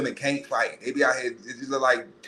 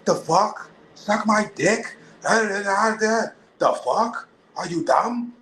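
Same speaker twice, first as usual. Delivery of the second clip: put-on offended tough girl; 9 semitones higher, much slower than usual. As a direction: bite back at the guy hitting on her. Tough girl snapping at catcaller.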